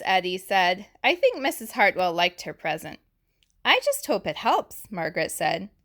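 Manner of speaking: put-on lively read-aloud for little kids; picture-book narration; story reading. A narrator's reading. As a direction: read the book brightly to the class. Reads picture book to class.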